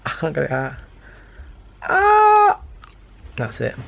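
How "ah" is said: high, loud yelp; a sudden strained yelp; sharp cry of effort. Real, not acted. Struggling to pull retainer out.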